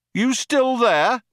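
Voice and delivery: high, slow, drawn-out call; cop calling out. A narrator's reading. Cop checks on the fugitives after firing, calling out. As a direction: holler to check for an answer. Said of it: "called one of the cops".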